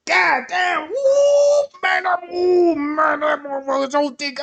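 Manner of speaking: high, loud hollering with whoops; excited shouting man. Retold or imitated. A man's inner voice seeing a thick woman, loud whooping holler. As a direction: holler like a man losing his mind. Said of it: "That man brain gonna be going all like this here".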